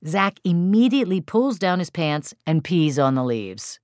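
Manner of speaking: plain reading voice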